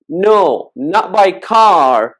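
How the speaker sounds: slow, emphatic denial leaning on CAR; contrastive emphasis. Shown as a demonstration. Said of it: "we kinda emphasize things by intonation".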